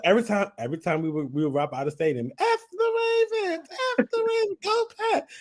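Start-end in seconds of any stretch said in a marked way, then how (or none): 2.4-5.3 high, shrill-pitched mock yell of a rival fan; yelled team taunt. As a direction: scream it out the car window like a fan. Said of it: "She was just like".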